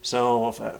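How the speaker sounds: usual speaking voice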